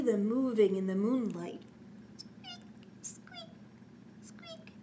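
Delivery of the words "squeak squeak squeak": thin high squeak, very soft; high squeaky noise. Acted. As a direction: squeak like a creaky floorboard. Imitating swings squeaking.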